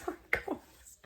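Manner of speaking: laughing whisper. Real, not acted. Laughing apology after sneeze.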